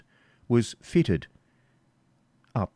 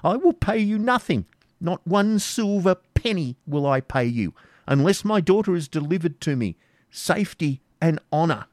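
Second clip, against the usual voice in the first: high, impassioned refusal; unbridled defiance; 10 semitones higher, 3 dB louder than usual. Acted. Isaac defying the baron, high and impassioned. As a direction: refuse him with passion. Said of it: "impossible to bridle".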